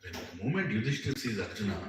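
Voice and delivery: usual speaking voice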